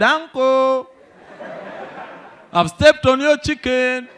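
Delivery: a child's cheeky taunt, lightly voiced. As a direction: play the mischievous kid announcing the deed.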